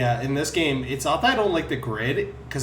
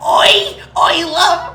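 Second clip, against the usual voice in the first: high, loud Cockney shout; shrill London barmaid yell; Cockney accent; 9 dB louder, much slower than usual. Retold or imitated. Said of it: "Pat did a voice that's basically like".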